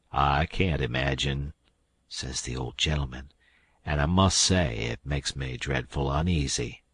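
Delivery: put-on a troubled old man keeping his voice down; worried old gentleman. A narrator's reading.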